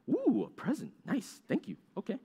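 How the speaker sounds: put-on cooing thanks; pleasantly surprised. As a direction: act out receiving a gift. Acts receiving a Christmas present.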